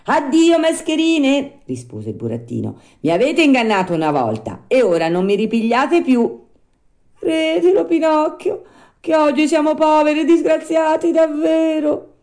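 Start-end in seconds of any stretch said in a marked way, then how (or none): 0.1-1.6 high, loud puppet send-off; dismissive farewell. Acted. Puppet dismisses the two swindlers. 3.0-6.4 raised, loud puppet voice; firm rebuff. Acted. Puppet rebuffs former swindlers. 7.2-12.1 very high, loud pleading wail; whining. A narrator's reading. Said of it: "con voce di piagnisteo".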